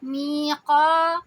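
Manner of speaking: میقا slow and loud, heavy قاف; Quran recitation. Shown as a demonstration. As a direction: hold both vowels in میقا two counts. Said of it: "دو دو حرکہ آواز لمبی کی، قاف کو heavy کیا". (میقا میں قاف بھاری اور دو حرکہ)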